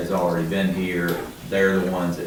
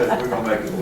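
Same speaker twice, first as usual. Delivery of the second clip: laughing; 3 semitones higher, much faster than usual. Real, not acted. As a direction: still chuckling at the end of a joke. Joking, then repeated plainly.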